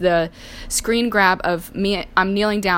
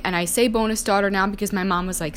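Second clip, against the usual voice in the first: ordinary talk, nothing marked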